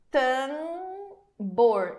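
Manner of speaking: "Tambor" with the A stretched and nasal, no separate M; nasal vowel demo. Shown as a demonstration. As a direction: hold the nasal "Tambor" long. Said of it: "um prolongamento do A". ("Tambor" com vogal nasal prolongada)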